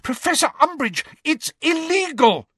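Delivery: high-pitched alarmed outcry; shrieked protest. A narrator's reading. Hermione protests that the curse is illegal, shrieking. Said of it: "shrieked Hermione".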